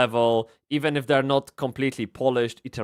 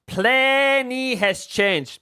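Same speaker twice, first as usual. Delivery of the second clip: "Plenty has changed" said high and very slowly; dramatic high drawl; 8 semitones higher, much slower than usual. Shown as a demonstration. Streamer dramatically repeats his point to chat, high and slow. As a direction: stretch "Plenty" high and drawn out for effect.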